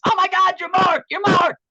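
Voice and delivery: put-on high, loud squeal of a star-struck girl; freaked-out fan. Girl at McDonald's freaks out at the actor, high squealing. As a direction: shriek it like a fan spotting a star. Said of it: "This girl freaked out at McDonald's and goes".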